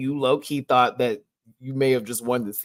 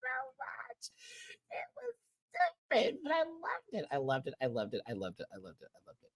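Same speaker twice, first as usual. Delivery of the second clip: fading repetition; 9 semitones higher, 12 dB quieter, faster than usual. Real, not acted. Repeats, fading to nothing.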